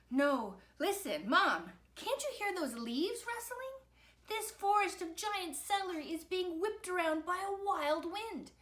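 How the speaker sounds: put-on high, urgent child voice; alarmed boy. A narrator's reading. Victor warns Mom about the rustling forest, alarmed. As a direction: plead urgently to be heard.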